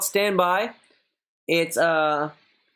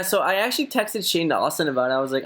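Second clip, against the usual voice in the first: ordinary talk, nothing marked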